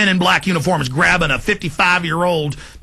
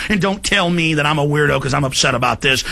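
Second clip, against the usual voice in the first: usual speaking voice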